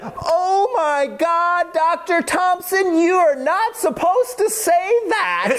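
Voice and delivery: put-on a scandalized boy, half crying, half laughing; teen patient squealing at his doctor in mock horror; mortified teen. Embarrassed teen patient reacting.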